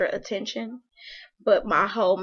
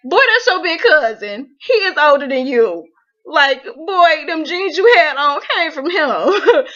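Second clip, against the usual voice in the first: exasperated scolding; 5 semitones higher, 9 dB louder than usual. Retold or imitated. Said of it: "And I'm sitting there like".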